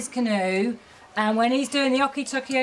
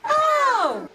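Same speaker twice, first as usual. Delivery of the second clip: very high sung squeak; squeaky baby bear voice; 11 semitones higher, 4 dB louder, slower than usual. Shown as a demonstration. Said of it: "the high squeaky baby voice".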